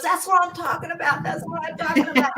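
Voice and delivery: high, fast gleeful brag; gloating excitement. Retold or imitated. Kim gloating at Cooper after the game, high and excited. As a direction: crow it like you just won. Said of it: "and she goes".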